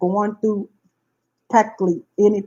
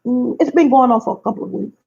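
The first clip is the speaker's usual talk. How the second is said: ordinary delivery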